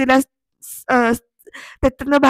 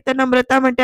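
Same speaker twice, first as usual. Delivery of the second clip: ordinary talk, nothing marked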